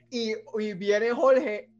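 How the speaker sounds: normal talking voice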